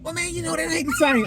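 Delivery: imitating his buddy's weak excuse, high and soft; friend's excuse. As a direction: mumble the excuse in a high, small mock voice. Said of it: "Then he had an excuse".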